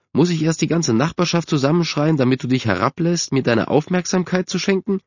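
high, exasperated scolding; cross mother. A narrator's reading. Mother scolding the son who ignores her, cross. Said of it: "rief seine Mutter ziemlich sauer".

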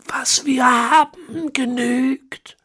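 put-on high, slow, whispery old man; ancient villain. A narrator's reading. Ezekiel explaining the horse bones, high and whispery. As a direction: rasp out the words like a centenarian.

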